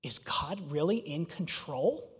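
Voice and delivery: whispered doubt; hushed fearful question. Retold or imitated. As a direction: breathe the question out unvoiced. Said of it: "and we go".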